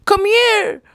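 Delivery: high mocking impression of a wife summoning her husband; imitating Dalia. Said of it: "She was like".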